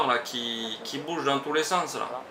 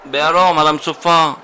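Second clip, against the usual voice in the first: loud call into the mic; shouting; 3 semitones higher, 12 dB louder than usual. Real, not acted. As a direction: call out her name loudly. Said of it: "ça m'a crié dans le truc".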